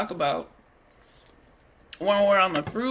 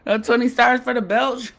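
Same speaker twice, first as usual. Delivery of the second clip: speaking through laughter; laughing; 2 semitones higher, 5 dB louder than usual. Real, not acted. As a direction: laugh while you say it. Laughing at own belch.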